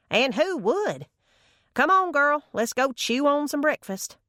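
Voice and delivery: put-on high, lively old woman; breezy grandma calling her girl; spry granny voice.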